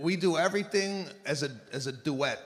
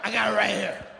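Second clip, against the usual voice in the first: mock triumphant; faster than usual.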